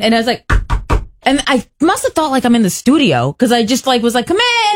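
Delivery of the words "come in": high, bright-pitched shouted invitation; calling out. Retold or imitated. Host retelling his bathroom blunder, calling out. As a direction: holler it through a door.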